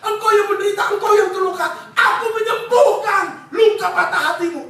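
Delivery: preacher voicing God's invitation loudly; urgent divine call. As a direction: call out to the weary with full force. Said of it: "Tuhan bertalu-talu berkata".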